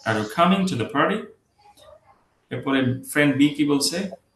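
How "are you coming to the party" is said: high stress on COMING, rising PARTY; "are you" and "to the" light, COMING strong, PARTY lifted; light function words. Shown as a demonstration. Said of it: "এগুলো হালকা".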